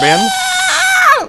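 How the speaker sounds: screeching animal yell, very high and loud; raccoon scream. Retold or imitated. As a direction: shriek like a raccoon in a fight. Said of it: "all I hear just outside the window is, is like".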